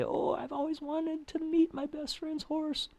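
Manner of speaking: high-pitched mock greeting; acted polite blunder. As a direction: say it in a put-on high voice. Acted accidental tone blunder quote.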